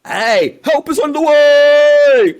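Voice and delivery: loud, slow drawn-out cartoon hero shout; heroic shout. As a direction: yell it to the rescue, dragging the words. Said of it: "I'm going to do a scene".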